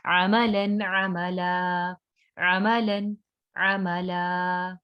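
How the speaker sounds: अमलन then अमला with the final a drawn out slowly; slow teaching demo, अमला stretched; stretched ending. Shown as a demonstration. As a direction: read slowly, pulling the last vowel of अमला long. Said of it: "हम इसको खींचेंगे".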